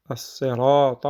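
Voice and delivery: As-sirata with a long held raa; lengthened madd. Shown as a demonstration. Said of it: "on a un mid".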